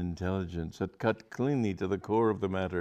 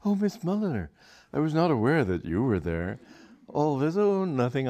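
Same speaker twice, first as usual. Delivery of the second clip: high, louder mock startle; feigned surprise; 7 semitones higher, 4 dB louder than usual. A narrator's reading. Aubrey pretends not to notice Charlotte, feigned surprise. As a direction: act caught off guard. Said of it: "Aubrey started dramatically".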